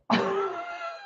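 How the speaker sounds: high laugh. Real, not acted. Laughing at comment.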